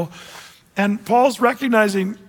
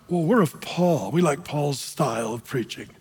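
lightly voiced low, softer partisan; hint of smug follower; 6 semitones lower, 4 dB quieter than usual. Preacher mimics Paul's fans in Corinth, lightly smug. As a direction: play a self-satisfied church member, lower and softer. Voicing a smug church faction.

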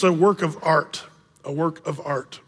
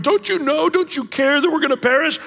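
frantic pleading; 7 semitones higher than usual. Retold or imitated. Disciples freaking out in storm.

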